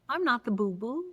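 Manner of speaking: ordinary talk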